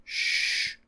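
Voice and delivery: soft, voiceless hush; shushing. Acted. One sister hushing the other, soft shush. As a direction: shush gently with breath only.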